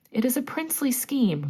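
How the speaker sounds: pitched high, pleased with the plan; a villain thrilled by his own idea; delighted cry. A narrator's reading. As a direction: crow over a grand scheme. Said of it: "cried Hook".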